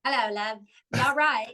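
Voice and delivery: put-on English accent greeting; lightly imitated English accent. Shown as a demonstration. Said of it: "do your English accent for me".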